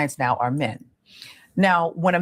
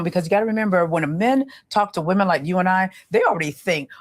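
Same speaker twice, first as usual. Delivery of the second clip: ordinary delivery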